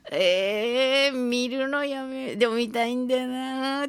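slow, drawn-out dithering groan; reenacted reluctant hemming and hawing; fidgety, hesitant. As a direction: waver aloud, stretching every vowel. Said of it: "もじもじしてる私を".

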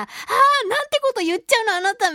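high, dragged gasp into scolding; scandalized mock outcry; mock shocked reproach. Voicing a shocked scolding gag reaction.

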